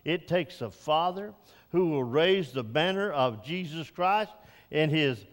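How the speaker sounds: normal talking voice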